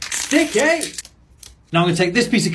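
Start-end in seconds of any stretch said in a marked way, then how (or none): 0.1-0.9 high-pitched playful catchphrase; high squeaky exclamation. Pretend. Catchphrase shouted at each sticker.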